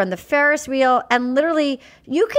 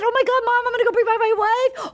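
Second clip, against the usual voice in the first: high excited quote; 11 semitones higher, faster than usual. Retold or imitated. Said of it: "he said".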